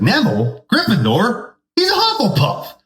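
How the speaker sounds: very high mock hat voice; sorting hat in a silly falsetto; squeaky sorting hat. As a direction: squeal the house verdict.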